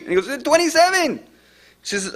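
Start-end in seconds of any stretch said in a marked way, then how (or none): 0.4-1.3 put-on very high accented cry; imitated panicked caller. Caller gives the street to dispatcher, imitated panic. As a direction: blurt it out urgently, pitch way up.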